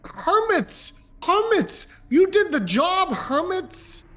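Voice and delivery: high-pitched cry to Hermits; calling out in character. Acted. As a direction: call out high and drawn, hailing a companion. Player calls out in character.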